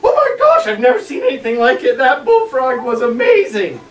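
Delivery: very high, loud awestruck gush; amazed excitement. Retold or imitated. Boy awestruck by the leaping frog, high loud gush. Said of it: "And I was like".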